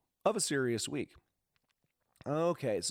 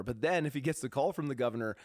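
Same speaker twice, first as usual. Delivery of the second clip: ordinary talk, nothing marked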